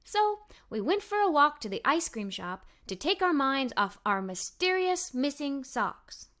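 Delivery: ordinary delivery, nothing marked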